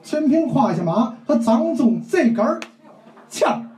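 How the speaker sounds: slow, emphatic mock storyteller in Tianjin dialect; 天津话评书腔; Tianjin-dialect storytelling. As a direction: drag out the phrases like a Tianjin storyteller. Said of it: "那你给学学".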